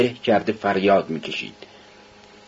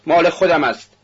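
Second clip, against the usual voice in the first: higher, forceful claim; defiant shout; 6 semitones higher than usual. A narrator's reading. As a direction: throw it back at the crowd. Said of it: "میکولکا فریاد زد". (فریاد لجوجانه و محکم)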